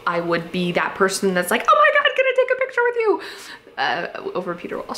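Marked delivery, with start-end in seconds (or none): 1.7-3.2 much higher, fast, squealing gush; starstruck fangirl. Pretend. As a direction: squeal like you just spotted your idol. Imagining meeting her hero.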